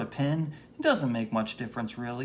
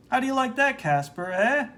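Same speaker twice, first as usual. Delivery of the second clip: taunting bully; 12 semitones higher, 5 dB louder than usual. Acted.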